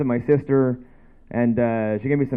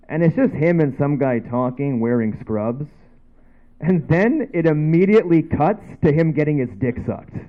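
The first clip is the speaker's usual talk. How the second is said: ordinary talk, nothing marked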